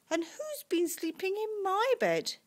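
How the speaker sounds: high, indignant Mummy Bear; story-time cross mother bear. A narrator's reading. Mummy Bear finds bed used.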